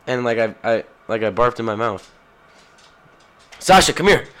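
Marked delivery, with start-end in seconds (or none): 3.6-4.3 calling the dog. Real, not acted. Calling her dog over to the mic. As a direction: call the dog's name, firm.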